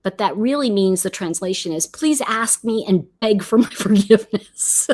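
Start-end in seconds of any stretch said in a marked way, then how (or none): 1.9-4.9 breaking into laughter mid-sentence; playful plea that cracks up at the end; laughing mock plea. Joking to viewers what "fine" really means, laughing.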